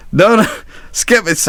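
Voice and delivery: high-pitched interrupting protest; mock-alarmed sailor cutting in; urgent crew reassurance. Crew rushing to the fallen captain, urgent mock reassurance.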